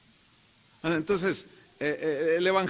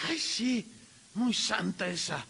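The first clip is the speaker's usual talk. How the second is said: sarcastic sneer; 2 semitones higher, 4 dB quieter than usual. Retold or imitated. Disciples mocking the woman, sarcastic. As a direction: sneer at her holiness.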